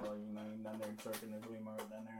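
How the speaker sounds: put-on quick, soft Southern accent. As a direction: re-enact a rambling Southern mumble. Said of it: "You got a Southern accent".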